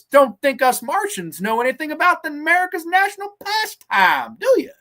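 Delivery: high, loud mocking old-timer voice; hammed-up impression, climbing high to taunt; creepy old man. Old man making fun of police testing his knowledge, high mocking impression. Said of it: "this creepy old man".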